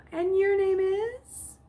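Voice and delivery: high, slow, pointed question; suspicious probing. Acted. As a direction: draw it out, doubting him. Nurse probing doubtfully.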